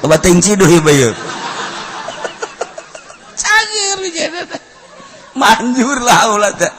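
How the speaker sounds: normal talking voice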